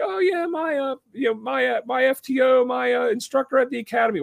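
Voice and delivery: high-pitched mock drunk kid. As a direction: imitate the eager tipsy probie brushing it off. Drunk kid names instructor.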